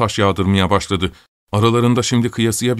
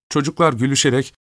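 ordinary delivery, nothing marked

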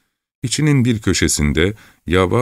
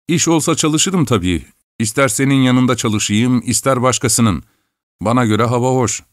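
put-on higher, eager young voice; smooth things over quickly; village lad explaining; 7 semitones higher than usual. A narrator's reading. Lad justifying himself to Chelkash, higher eager voice.

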